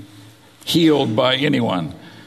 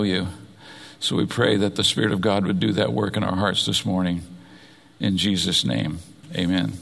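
closing prayer; 3 semitones lower than usual. Acted.

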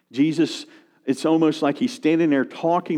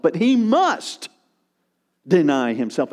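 "must" raised and slowed in "but he must deny himself"; emphatic must; 2 semitones higher than usual. Shown as a demonstration. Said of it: "that's the emphatic nature of the verb".

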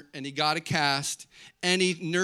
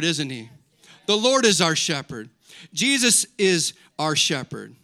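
louder, raised scripture proclamation; emphatic preaching; 5 semitones higher, 6 dB louder than usual. Real, not acted. Pastor declaring the Good Shepherd to church, emphatic preaching. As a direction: proclaim it to the whole congregation.

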